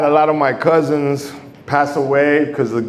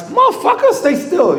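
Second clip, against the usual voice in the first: sudden much higher yelp of frustration; exasperated cry. Retold or imitated. Boxer frustrated at a slippery opponent, high exasperated yelp. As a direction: squeal it out at a fighter who won't hold still. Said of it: "and you're like".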